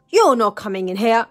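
loud, quick bellowed refusal. A narrator's reading. Rude man barring the princess from his house, bellowing. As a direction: bellow it at an unwanted visitor. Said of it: "he bellowed".

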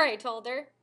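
usual speaking voice

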